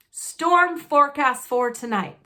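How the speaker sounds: gleeful Uncle Vernon. Acted. Uncle Vernon gloating to the family about the storm. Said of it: "said Uncle Vernon gleefully".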